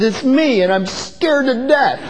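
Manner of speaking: put-on shaky, scared child's voice; quivering voice. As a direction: tremble it out like a frightened boy. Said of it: "with quivering voice".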